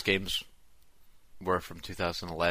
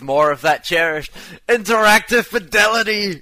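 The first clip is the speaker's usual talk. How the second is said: very high, loud put-on voice; pompous website voice; 12 semitones higher, 13 dB louder than usual. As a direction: declaim it grandly and shrilly. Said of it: "you reading this".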